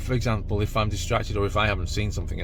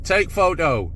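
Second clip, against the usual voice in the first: higher, louder command to the camera; clear voice command; 7 semitones higher, 4 dB louder, slower than usual. Acted. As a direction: call it out clearly to the dash cam. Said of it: "my more clearer telephone video voice".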